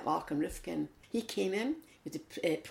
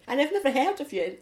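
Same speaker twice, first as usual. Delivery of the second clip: indignant; 11 semitones higher, 7 dB louder than usual. Retold or imitated. Retorting friend, indignant.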